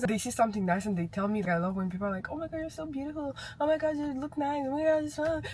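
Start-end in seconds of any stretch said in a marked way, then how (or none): 2.3-5.4 higher, breathy-free gushing imitation; lightly voiced gushing fan; gushing admirer. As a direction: mimic people fawning over you. Imitating people's compliments.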